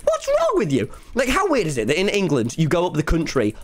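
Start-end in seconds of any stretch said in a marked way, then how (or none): 0.0-0.9 very high-pitched mock disbelief; incredulous outburst. Streamer retells reacting to people in LA, shrieky high incredulity. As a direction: squeal it in exaggerated bafflement. Acts out his appalled reaction.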